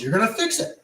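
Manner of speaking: high-pitched imitated insistence; demanding homeowner. Homeowner orders installer to fix power, imitated high demand.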